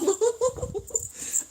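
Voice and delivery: high-pitched giggle; real amused chuckle. Real, not acted. Laughing at daughter's remark on her accent, high giggle.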